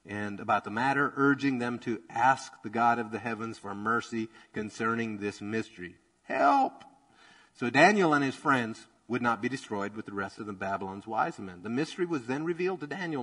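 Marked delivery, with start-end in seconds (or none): 6.2-6.8 high, sudden pretend plea; quick, high, half-joking cry of help; mock cry for help. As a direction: yelp it like a desperate prayer. Friends praying for mercy.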